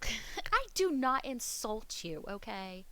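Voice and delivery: slow emphatic words through laughter; laughing, drawn-out protest. Real, not acted. Laughing denial to chat.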